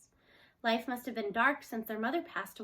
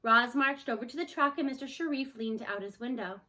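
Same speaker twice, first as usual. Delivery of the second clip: ordinary delivery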